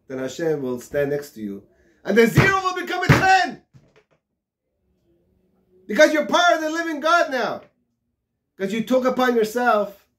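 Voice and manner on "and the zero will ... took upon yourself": high, loud fervent sermon; fiery preaching. Acted. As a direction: preach it out to the whole congregation. Preaching at full force.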